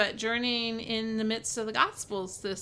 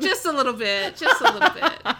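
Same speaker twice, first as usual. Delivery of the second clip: real laugh through the words, louder; 4 semitones higher, 9 dB louder than usual. Real, not acted. Speaker teased for going blue, laughing as she admits it. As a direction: admit it while laughing, voice rising high.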